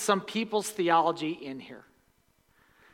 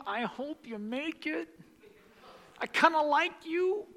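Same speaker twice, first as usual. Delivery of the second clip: high-pitched, jokey send-off with a laugh; mock half-hearted encouragement; 7 semitones higher, 5 dB quieter than usual. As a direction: imitate an unsure well-wisher, pitched up high. Mock version of Jesus' words.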